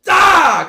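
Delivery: high, loud frustrated "Ah"; angry kid yell. Retold or imitated. Angry little kid with no words, loud yell. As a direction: blurt a wordless toddler rage noise. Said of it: "I would just, like, make a noise".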